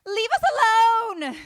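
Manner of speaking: high, loud mock panic cry; hammed-up frantic plea; freaking-out mom. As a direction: wail it like an overwrought mother. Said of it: "freaking out".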